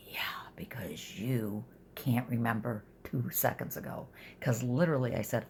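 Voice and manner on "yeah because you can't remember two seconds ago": whispered jab, under her breath; whispered aside. Retold or imitated. Daughter whispering a retort about her mother. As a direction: hiss it so Mom can't hear.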